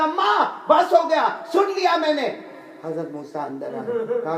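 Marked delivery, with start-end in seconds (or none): 0.0-2.3 high, loud, impatient outburst; exasperated call. Retold or imitated. Son telling his mother he has heard enough, exasperated. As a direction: cut her off loudly, pitch raised.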